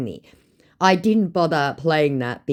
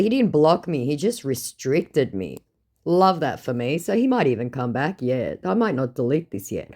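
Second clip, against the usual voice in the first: ordinary talk, nothing marked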